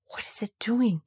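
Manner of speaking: soft breathless whisper; whispered wonder. Acted. Colin seeing the lamb, awed whisper. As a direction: hush it in amazement. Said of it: "cried Colin".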